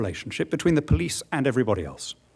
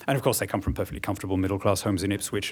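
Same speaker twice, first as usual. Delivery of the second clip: ordinary delivery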